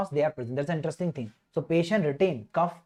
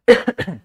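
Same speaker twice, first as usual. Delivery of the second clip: loud cough; 3 semitones higher, 12 dB louder than usual. Real, not acted.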